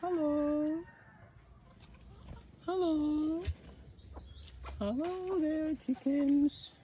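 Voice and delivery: very high, soft greeting to the hens; high sing-song greeting. Real, not acted. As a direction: greet the chickens up high and sweet. Greeting the chickens.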